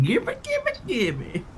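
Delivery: shrill greedy high-pitched gimme; greedy squeaky gimme. Retold or imitated. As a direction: squeal it like a grabby goblin. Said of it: "I'm like".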